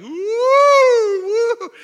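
loud, high whooping wind sound; storm noise. Retold or imitated. Preacher imitating the storm rocking the boat, loud high whooping. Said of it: "This boat is going like".

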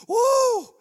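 a high, loud emotional woo, overcome; emotional whoop. Real, not acted. Preacher overcome by gratitude, loud emotional woo. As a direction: let a burst of feeling out as a high cry.

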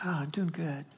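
whispered hello from the officer; barely voiced, gentle answer; soft, almost whispered reply.

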